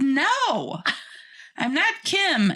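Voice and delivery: amused, high-voiced retort; retold protest. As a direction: protest it high, breaking into laughter. Acted retort to drive-thru worker.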